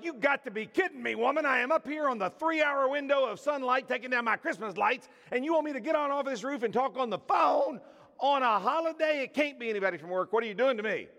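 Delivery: high, loud exasperated complaint; exasperated rant. Retold or imitated. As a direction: protest like a man dragged off a roof. Exasperated retort to wife.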